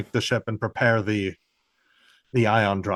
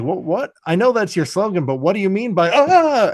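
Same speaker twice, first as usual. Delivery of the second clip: a higher, louder sneering villain voice rising to a squeal; mocking villain; 8 semitones higher, 6 dB louder than usual. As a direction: play the villain taunting, pitch climbing high.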